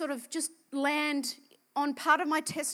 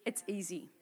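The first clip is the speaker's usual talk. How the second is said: whispered; 7 semitones lower, 11 dB quieter than usual. Acted. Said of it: "whispered close".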